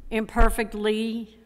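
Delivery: usual speaking voice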